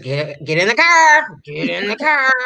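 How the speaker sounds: imitating a stern order; mock stern. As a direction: draw out the last word of a mock command. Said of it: "she was just being stern".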